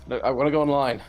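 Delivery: mock insistence. Retelling the AI refusing the advice, mock insistent.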